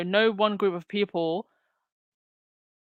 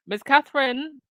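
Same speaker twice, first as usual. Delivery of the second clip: "Miss Catherine" sung out higher with a rising tune; sarcastic emphasis; 7 semitones higher than usual. Real, not acted. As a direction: lift "Miss Catherine" up high and let it rise, teasingly. Repeats the name with mock emphasis.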